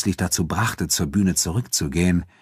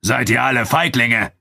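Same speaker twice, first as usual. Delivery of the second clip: higher, projected taunt; Abu Dun calling out; 6 semitones higher, 4 dB louder than usual. A narrator's reading. Nubian fighter to the unwilling spectators, calling out. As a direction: goad the whole crowd with a raised voice. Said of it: "rief Abu Dun".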